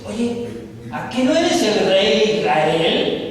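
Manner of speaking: wife scolding her weak husband; angry wife. Acted. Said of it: "su mujer se enoja". (esposa enojada, regañando más fuerte)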